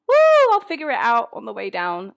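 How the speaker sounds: high, loud whoop sliding down into speech; mock cry of someone leaping off a cliff; gleeful whoop. Jumping off the cliff kamikaze style, gleeful whoop.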